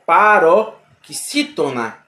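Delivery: "paroxítona" drawn out slowly, syllable by syllable, stressing XÍ. Shown as a demonstration. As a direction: sound out "paroxítona" slowly, weight on XÍ. ("paroxítona" bem devagar, força no XÍ)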